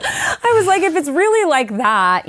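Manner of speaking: high, laughing mock bravado; high and laughing, talking herself into it. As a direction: say it through laughter, half joking. Laughing quoting her own bravado.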